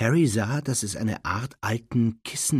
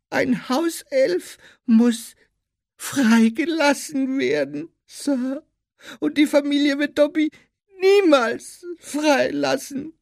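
high, plaintive squeaky elf; Dobby; 8 dB louder than usual. A narrator's reading. Elf laments he will never be freed, plaintive squeak. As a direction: squeak it mournfully, in drawn-out phrases.

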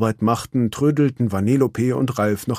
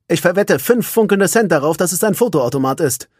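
a touch of tough sergeant, higher; Kalun's voice; 8 semitones higher, 5 dB louder than usual. Acted. Kalun bets her husband, tough as ever. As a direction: place the bet, cool and sure.

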